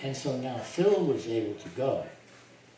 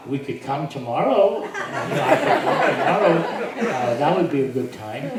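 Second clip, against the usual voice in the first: eager, laughing reply, louder; 4 semitones higher, 12 dB louder than usual. Retold or imitated. Telling Harry Kenmore when he can come, laughing. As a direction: blurt it out between laughs.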